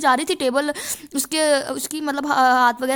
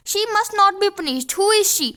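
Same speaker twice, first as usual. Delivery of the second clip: slow, careful reading of the text line; slow dictation read; 4 semitones higher, much slower than usual. Shown as a demonstration. Teacher to students, reading the passage slowly. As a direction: read it out slowly for the class to copy.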